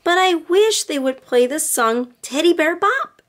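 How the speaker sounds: lightly voiced little girl wishing; little girl Lisa.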